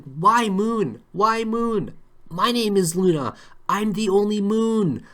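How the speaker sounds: high, loud howl at the moon; mock drunken yelling. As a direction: play a hammered dog wailing skyward, loud and high. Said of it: "she was yelling at the moon".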